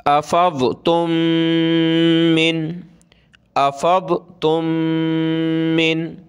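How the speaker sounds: अफ़ज़तुम मिन with the two m merged and hummed; nasal meem. Shown as a demonstration. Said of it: "नाक में आवाज़ पैदा करेंगे".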